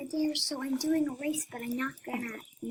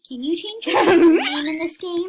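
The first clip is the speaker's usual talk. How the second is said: laughing; 7 semitones higher, 16 dB louder than usual. Real, not acted. Laughing question.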